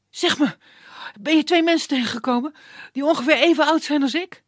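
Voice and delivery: high, loud and fast hurried youth; impatient young man. Acted. Dimitri pressing the woodsman for directions, high hurried voice. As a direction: rush him, no time to lose.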